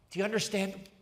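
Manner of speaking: ordinary delivery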